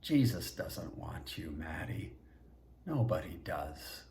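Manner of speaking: faux empathy. Governor looms over fallen Maddie, fake sympathy. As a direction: pity that is really a jab. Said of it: "said with faux empathy".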